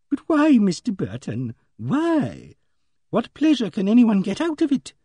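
high, drawn-out, pleading question; bewildered Emily Barton. A narrator's reading. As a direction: plead for an answer, pitched high.

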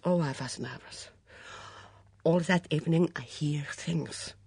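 put-on Mitzi, nervous then bitter, in a foreign accent. Mitzi voiced by narrator.

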